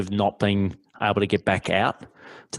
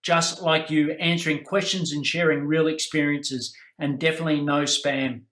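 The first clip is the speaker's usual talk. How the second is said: bright, higher, upbeat ad read; promo read; 6 semitones higher than usual. Acted. Host plugging the group to listeners, upbeat ad read. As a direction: pitch the Facebook group like a radio ad.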